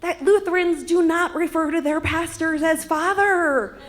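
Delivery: high, loud exasperated rant; mounting, indignant kid's explanation; exasperated explaining. Acted. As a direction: scold a friend for a huge blunder. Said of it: "she turns to me and explains".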